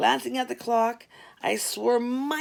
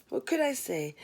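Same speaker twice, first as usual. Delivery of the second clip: plain reading voice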